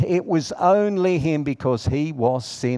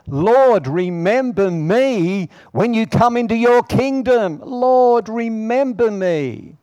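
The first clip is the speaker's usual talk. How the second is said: higher, louder desperate plea to the Lord; pleading cry; 10 semitones higher, 6 dB louder than usual. Retold or imitated. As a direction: cry it out like a dying man begging. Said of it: "who cried out to him".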